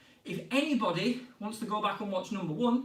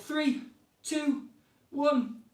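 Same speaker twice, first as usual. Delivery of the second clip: rising countdown; 6 semitones higher, much slower than usual. Real, not acted. Coach counting kids down to stop the drill, rising call.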